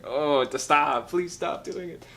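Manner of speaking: slow, high, pleading cringe; mock pained plea; cringing plea. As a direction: beg them to stop, wincing.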